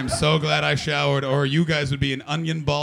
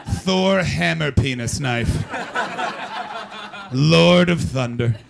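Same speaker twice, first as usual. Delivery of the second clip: mock epic title; 3 semitones higher, 6 dB louder, slower than usual. Dubbing audience member a Viking.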